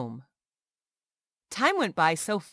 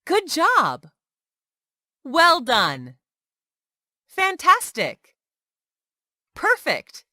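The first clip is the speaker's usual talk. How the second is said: higher and louder, bursting with praise; enthusiastic praise; 7 semitones higher, 6 dB louder, slower than usual. Shown as a demonstration. Teacher praising a learner's good work, excited. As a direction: cheer them on like a proud teacher.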